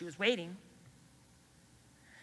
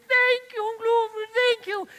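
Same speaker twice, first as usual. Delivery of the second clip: much higher, louder thrilled thanks; grateful girl; 12 semitones higher, 11 dB louder than usual. Acted. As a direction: burst out with joyful thanks. Mazindaba thanks elephant.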